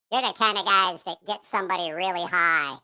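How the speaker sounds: ordinary talk, nothing marked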